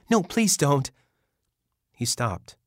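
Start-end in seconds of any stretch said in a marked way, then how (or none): 0.1-0.9 high, loud desperate plea; pleading cry. Acted. Mother begs her son to stay, a high loud plea. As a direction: cry out to stop him leaving.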